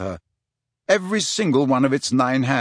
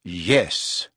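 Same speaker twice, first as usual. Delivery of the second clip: weary; 7 semitones lower than usual. A narrator's reading. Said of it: "came Mister Weasley's weary voice".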